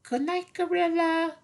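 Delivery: tiny high mouse voice with a rise on night; little mouse voice. Acted. Little mouse saying goodnight to gorilla in a squeaky high voice. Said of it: "the little mouse says".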